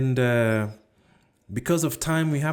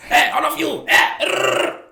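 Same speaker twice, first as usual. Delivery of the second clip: high, loud mock-tough yell with a rolled growl; gangster Claire; 8 dB louder than usual. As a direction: play the rowdy street toughie barking at everyone, hammed up. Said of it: "the gangster Claire".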